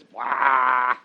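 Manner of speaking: loud, blunt mock camel bellow; camel blah. Dad inventing a camel sound for his little girl, loud blurted blah. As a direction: blurt a silly animal noise. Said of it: "the only thing I can come up with is".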